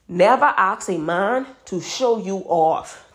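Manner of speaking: usual speaking voice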